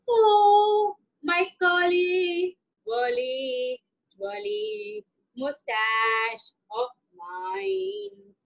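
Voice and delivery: a proud king savouring every word about his mustache; vain Raja. Acted. King admiring himself in the mirror, slow and vain.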